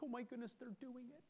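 a parent amazed at the first steps; excited parent. Retold or imitated. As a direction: marvel as the baby starts to walk. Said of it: "you're like".

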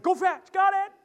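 high, loud, excited yips; eager dog voice. Retold or imitated. Preacher as a dog chasing a ball, high loud excitement.

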